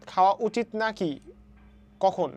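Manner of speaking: ordinary delivery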